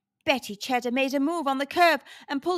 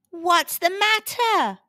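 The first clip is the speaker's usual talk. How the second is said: higher-pitched taunt; mocking laugh; 6 semitones higher, 4 dB louder than usual. A narrator's reading. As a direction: jeer at a rival, amused. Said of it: "laughed Lester Limburger".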